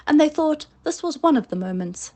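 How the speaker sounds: plain reading voice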